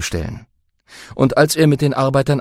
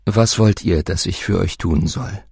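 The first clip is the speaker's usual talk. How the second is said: Jesus speaking; 6 semitones lower than usual. Acted. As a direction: lower gentle Jesus. Jesus asks the blind.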